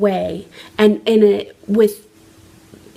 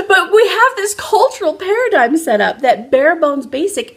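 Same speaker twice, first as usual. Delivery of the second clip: laughing; 8 semitones higher, 4 dB louder than usual. Real, not acted. Still laughing after the redneck bit.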